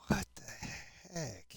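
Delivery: slow, breathy whisper of disbelief. Real, not acted. Whispering to himself when his bucket is gone, slow and disbelieving. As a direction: hush it out, baffled.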